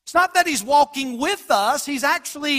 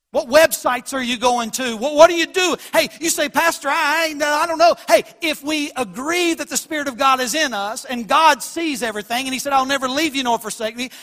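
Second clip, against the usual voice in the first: preacher confronting his listeners; pressing preaching; 2 semitones higher, 5 dB louder than usual. Acted. Pastor challenging the congregation on how they live, urgent preaching.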